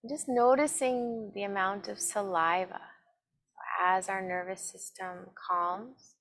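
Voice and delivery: slow, calm meditation voice with long pauses; guided meditation. Acted. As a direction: guide them gently inward, evenly and unhurried. Leads a seated meditation.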